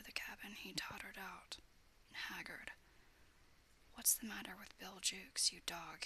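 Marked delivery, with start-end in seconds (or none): none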